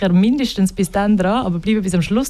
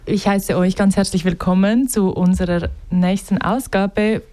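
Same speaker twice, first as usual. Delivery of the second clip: Standard German. Real, not acted. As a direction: say it in Standard German, not Swiss dialect. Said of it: "ich spreche auf Hochdeutsch".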